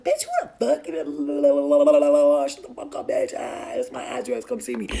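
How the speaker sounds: hammed-up gangster tirade, starting explosively; old gangster flow. Mother acting out her old self, gangster flow. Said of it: "The old gangster flow".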